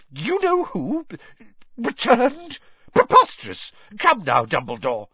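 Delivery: spluttering. Acted. Fudge spluttering at news of Voldemort's return. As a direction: splutter in disbelief. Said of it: "He began to splutter".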